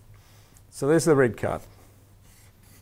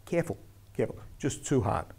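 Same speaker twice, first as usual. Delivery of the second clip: hushed, soft caution; quiet friendly word; 6 dB quieter than usual. Shown as a demonstration. Referee showing trainees the quiet word, soft and friendly. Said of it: "Listen to the way I say".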